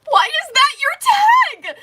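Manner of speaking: high, loud and slow, mock outrage at the tag; loud teasing. Real, not acted. Streamer teasing a viewer over their tag, loud and high. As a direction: drag it out in loud playful disbelief. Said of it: "teasing chat about a viewer's tag".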